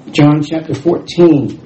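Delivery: ordinary talk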